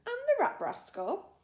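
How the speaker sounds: high, loud eager question; excited question. A narrator's reading. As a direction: push it up with glee. BFG listing creatures.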